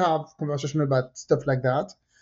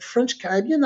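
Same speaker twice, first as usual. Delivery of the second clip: mildly puzzled echo, pitched up at the end; retold confusion; 6 semitones higher than usual. Woman questioning the speaker's French Caribbean origin, mock confusion. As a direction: imitate someone baffled by the answer. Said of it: "Another confusion".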